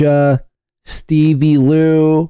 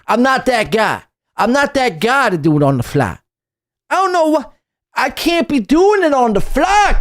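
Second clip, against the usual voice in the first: higher, hammed-up street voice; mock gangster; 3 semitones higher than usual. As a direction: parody her as a wannabe thug. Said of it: "Why are you talking like".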